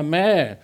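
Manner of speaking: loud imitated bleat; sheep bleat. Joke's sheep bleating at the lawnmower, loud bleat. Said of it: "Sagt das Schaf zum Rasenmäher".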